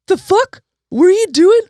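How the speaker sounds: high, loud, hostile yell; furious shout. Retold or imitated. Drunk man at bar yelling at deaf man, high angry shout. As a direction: bark it out like a drunk picking a fight.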